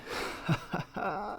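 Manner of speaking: quiet, pleased little laugh; soft chuckle. Real, not acted. Collector delighted by the main attraction, soft chuckle. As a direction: chuckle softly to yourself.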